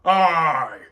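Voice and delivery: loud, slow, commanding tyrant; booming cosmic tyrant; tyrannical Thanos voice. Acted. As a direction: proclaim yourself lord and master. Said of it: "the tyrannical Thanos voice".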